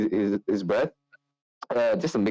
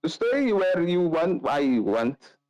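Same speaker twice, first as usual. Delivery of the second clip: higher, slow, dopey; drunk muddle; 9 semitones higher, much slower than usual. Acted. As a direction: mumble a drunk answer. Carl drunk reply.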